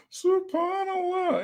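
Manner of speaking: a long, high Subhanallah. Real, not acted. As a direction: sing it out in mock disbelief. Repeat of drawn-out exclamation.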